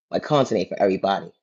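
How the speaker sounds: ordinary talk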